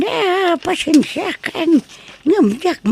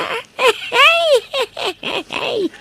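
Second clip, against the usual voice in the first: high laugh; 8 semitones higher than usual. Acted. Grandmother laughs.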